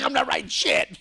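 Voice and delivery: high, loud refusal through laughter; laughing. Real, not acted. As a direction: crack up while retelling a defiant answer. Laughing at his own retold refusal.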